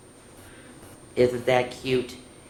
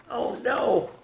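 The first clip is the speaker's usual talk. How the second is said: high little worried aside; a storyteller's playful alarm, pitched up; mock dismay; 11 semitones higher than usual. Storyteller reacting to the mouse seeing the cat, mock dismay.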